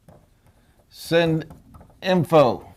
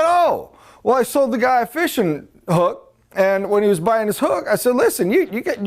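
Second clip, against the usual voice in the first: cocky salesman; 7 semitones higher than usual. Acted. Salesman character in joke.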